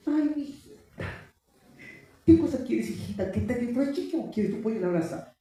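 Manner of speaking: high, cooing mock parent; doting parent. Pastor mocks parents pampering their little daughter, high and cooing. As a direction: coo like an overindulgent parent. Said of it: "los padres de hoy día son".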